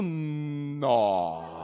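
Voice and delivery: long drawn-out Mineiro "nó"; Mineiro interjection. Shown as a demonstration. Comedian revealing Minas's favourite interjection, drawn-out Mineiro "nó". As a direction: hold the Mineiro "nó" on a long opening hum. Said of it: "A interjeição que a gente mais usa".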